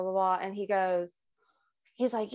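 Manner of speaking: normal talking voice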